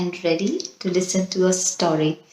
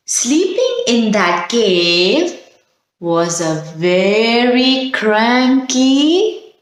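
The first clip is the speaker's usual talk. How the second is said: drawn-out hushed build to the bear; suspenseful slow reveal; much slower than usual. Acted. Teacher slowing the story down for children, suspenseful. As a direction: stretch each word to build suspense.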